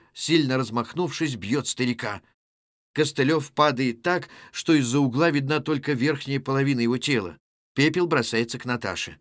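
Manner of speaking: ordinary talk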